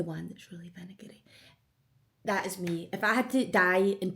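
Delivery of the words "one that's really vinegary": a savouring whisper; whispered aside. Real, not acted. Vlogger describing her favourite sauce, whispered. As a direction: breathe it like a secret treat.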